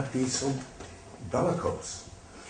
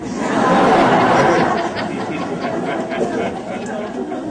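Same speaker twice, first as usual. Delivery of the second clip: breathless laugh; 9 semitones higher, 8 dB louder than usual. Real, not acted. Father laughing, taking credit.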